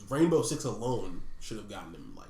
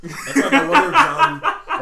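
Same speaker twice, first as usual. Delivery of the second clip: high, loud laughing speech; words squeezed out through laughter; laughing hard; 15 dB louder than usual. Real, not acted. Laughing at the French accent story, laughing hard.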